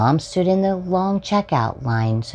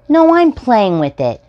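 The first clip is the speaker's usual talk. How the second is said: put-on loud, much higher kid's yell; shouting boy; 12 semitones higher, 8 dB louder than usual. Another boy refusing to give up the truck, high loud yell. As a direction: yank back and shout like a stubborn little boy.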